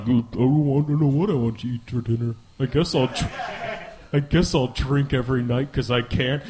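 a low, gloomy mumble of the sulking self; mopey inner voice. Retold or imitated. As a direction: sulk it out like someone stuck in a rut. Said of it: "Hell is like".

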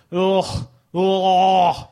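loud, hammed-up ugh; mock exasperated ugh; disgusted groan. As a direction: groan like it's unbearable.

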